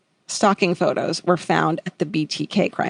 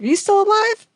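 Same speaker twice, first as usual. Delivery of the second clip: alarmed question; 12 semitones higher than usual. Retold or imitated. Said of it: "they were like".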